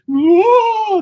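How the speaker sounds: high loud strained whoa; mock groan of effort. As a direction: yelp as if standing up stiffly. Standing up with aching knees.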